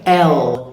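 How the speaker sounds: "L" drawn out, tongue tip to upper teeth; lazy, lingering "L"; American dark L. Shown as a demonstration. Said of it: "tip of your tongue".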